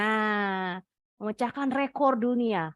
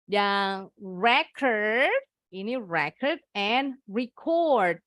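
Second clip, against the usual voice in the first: record with stress on RE- then on -CORD; slow contrast of record stresses; slower than usual. Shown as a demonstration. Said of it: "penekanannya ada di suku kata kedua". (record: tekanan suku pertama lalu kedua)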